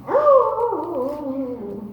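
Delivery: hammed-up hound howl; dog howl. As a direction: howl like the old porch dog. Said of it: "the dog's going".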